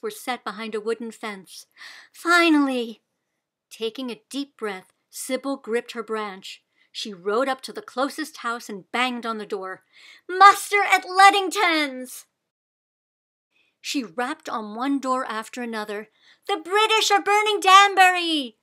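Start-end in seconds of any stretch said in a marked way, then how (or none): 2.1-3.0 relieved. A narrator's reading. Relief at reaching houses. 10.3-12.3 much louder, higher alarm call; shouting. A narrator's reading. Banging on doors, calling out. 16.5-18.6 much louder, higher warning; alarm shout. A narrator's reading. Calling to villagers.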